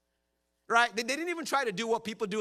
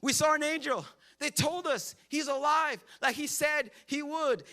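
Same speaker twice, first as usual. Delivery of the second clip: excited women; 6 semitones higher than usual. Acted. Women excitedly telling disciples.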